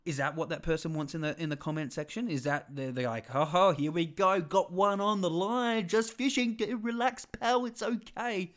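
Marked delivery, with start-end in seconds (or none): 3.3-8.5 high, slow smug troll voice; goofy high fishing-troll voice; mocking troll voice. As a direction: taunt like a troll reeling someone in. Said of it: "Again, these voices".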